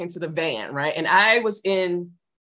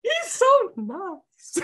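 high, slow, laughing gush; gushing fan; 14 semitones higher, much slower than usual. Retold or imitated. Fan gushing about the star, high slow swoon. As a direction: swoon over how nice he is. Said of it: "it's like".

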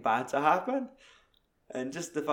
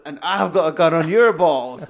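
loud, higher hammed-up threat; 4 semitones higher, 11 dB louder than usual. Retelling Hugo's entrance line to a friend, loud mock threat. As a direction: bark it like bursting into the room.

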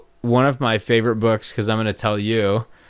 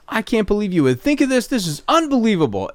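raised, indignant reading of the text; mad; 10 semitones higher than usual. Shown as a demonstration. Reading a friend's text as an insult, mad. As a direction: sound fed up and offended. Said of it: "and I'm mad".

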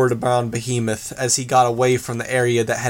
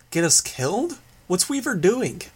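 higher, quick alarmed questions; alarmed and confused; 7 semitones higher than usual. A narrator's reading. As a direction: blurt it out in a panic. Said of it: "sounding alarmed and confused".

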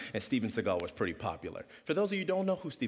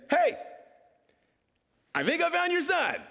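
very high, acted triumphant holler; hammed-up guard's announcement; excited calling out; 4 dB louder than usual. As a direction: call across to a stranger with big news.

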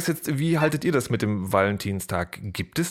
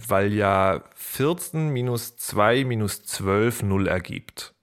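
slow deadpan; 2 semitones lower, much slower than usual. Acted. Spelling out silly math slowly.

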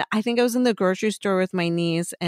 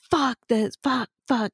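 slightly raised, mock-angry cursing; retold angry swearing; 5 semitones higher than usual. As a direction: act out cursing while pissed off. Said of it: "pissed off about something".

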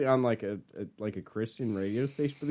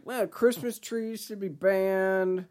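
mock offended person; 7 semitones higher, slower than usual. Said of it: "Next year it'll be like".

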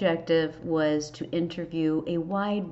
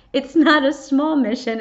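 emphatic; 7 semitones higher, 9 dB louder than usual. Real, not acted. Emphatic statement of her mission.

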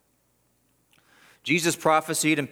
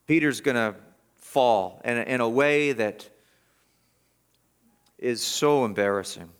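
normal talking voice

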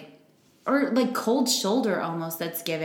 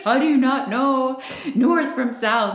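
slow, higher and louder, disbelieving father; exasperated dad; 6 semitones higher, 5 dB louder, slower than usual. Retold or imitated. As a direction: drag it out like a baffled dad scolding his kid. Exasperated dad quizzing daughter.

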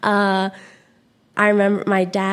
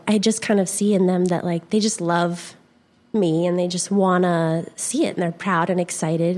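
ordinary talk

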